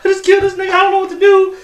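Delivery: high, loud mock-panicked girl; girl best friend. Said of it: "best friend's a girl, it's like".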